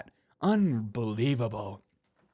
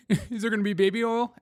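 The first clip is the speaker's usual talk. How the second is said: high squeaky joking question; a silly high-voiced gasp of a question; mock squeal; 8 semitones higher than usual. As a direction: pitch it way up in mock alarm.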